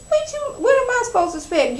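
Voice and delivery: high, fast indignant comeback; mock-offended Kim snapping back; indignant retort. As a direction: fire it back at her, offended. Kim snaps back at Kenya.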